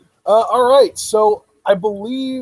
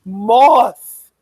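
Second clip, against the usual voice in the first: loud, raised one-word cry; imitating panicked outburst; 5 semitones higher, 8 dB louder than usual. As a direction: blurt the name in mock panic. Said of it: "she's panicking and freaking out".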